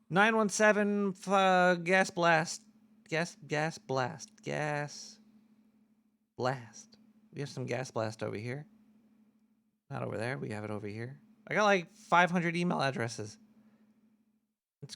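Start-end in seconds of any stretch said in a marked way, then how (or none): 3.1-11.2 low murmur. Acted. Host announcing gas blast segment, low and soft. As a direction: drop the voice low and drag it.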